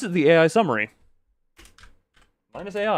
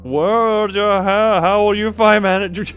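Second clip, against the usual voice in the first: mock dramatic; 5 semitones higher, 7 dB louder, slower than usual. Mocking the game's words.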